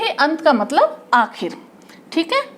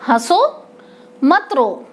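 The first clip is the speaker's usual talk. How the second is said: "हंसो" held apart, then "मत रो" as one unit; pause after हंसो; slower than usual. Shown as a demonstration. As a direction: break after "हंसो", before "मत रो". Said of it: "ऐसे ही इसने दूसरी बात कही".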